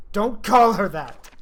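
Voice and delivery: furious shout. Acted. Defending the dead friend's dignity, furious shout. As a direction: bite off every word.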